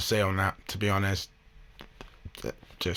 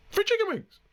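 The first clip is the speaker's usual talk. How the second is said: mock Karen. Mocking the Karen's complaint.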